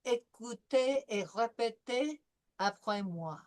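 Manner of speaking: careful French, "Écoutez" with a clean "é" and a French r in "répétez"; French instruction. Shown as a demonstration. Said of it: "I gave you a phrase".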